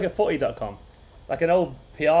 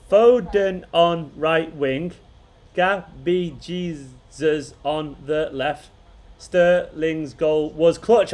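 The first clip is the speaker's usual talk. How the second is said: each word drawn out slowly, haltingly; slow haiku reading; much slower than usual. Shown as a demonstration. Streamer reading a chat haiku to viewers, slowly. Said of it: "I gotta say it like a proper haiku".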